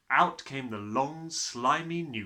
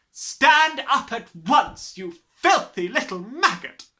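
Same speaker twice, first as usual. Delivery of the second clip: loud, drawn-out command; slow furious roar; 12 semitones higher, 13 dB louder, slower than usual. A narrator's reading. Headmistress repeating her order to Matilda, slow and loud. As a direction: hammer each word.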